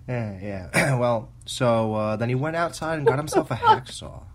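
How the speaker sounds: put-on Boston accent, creepy old gravekeeper; old Boston gravekeeper telling a tale. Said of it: "was that your Boston accent I heard".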